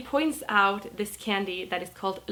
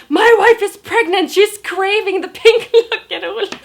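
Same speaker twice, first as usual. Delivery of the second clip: much higher, loud mock yell breaking into a laugh; 11 semitones higher, 13 dB louder than usual. Customer yells about his pregnant wife, much higher and louder, laughing. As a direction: yell it at everyone at once, hammed up. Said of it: "yelling at his wife as well as me".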